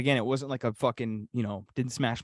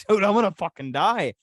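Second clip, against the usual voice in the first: louder, higher desperate protest; retold protest; 7 semitones higher, 7 dB louder than usual. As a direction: shout it at the boss like you might die. Retold plea to the boss.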